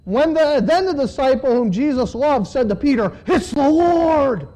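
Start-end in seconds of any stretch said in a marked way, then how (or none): 3.3-4.5 high, drawn-out cry of recognition; excited exclamation. A narrator's reading. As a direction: exclaim it slowly, pitched up. Disciple's exclamation to Peter, read aloud.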